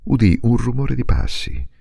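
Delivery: plain reading voice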